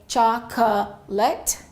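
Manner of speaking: 'chocolate' in three separated beats, middle o sounded; three-syllable mispronunciation. Shown as a demonstration. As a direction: say 'chocolate' broken up slowly as chok-o-lut. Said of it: "So we don't say".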